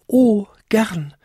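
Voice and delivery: high whispered little-bell reply; eager tiny bell whisper. A narrator's reading. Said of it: "bimmelte Vinzi". (hohes, geflüstertes Glöckchen)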